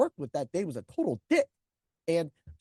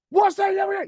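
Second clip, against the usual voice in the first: put-on loud angry yell at a kid; retold shout; 13 semitones higher, 7 dB louder than usual. Teacher yelling at the student, loud angry shout. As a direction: shout it in his face. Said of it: "He was just shouting everything".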